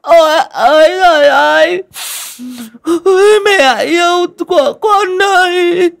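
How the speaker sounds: high, loud, slow hammed-up mourning wail; forced wailing. A narrator's reading. Daughter-in-law faking grief over the mother-in-law, slow loud wailing. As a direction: force out a showy sobbing lament. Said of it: "phùng mang trợn má nhưng vẫn phải cố".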